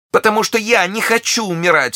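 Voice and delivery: shrieking very high, loud; terrified cry. A narrator's reading. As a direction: struggle and cry out in terror. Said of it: "вскричала миледи". (отчаянный крик ужаса)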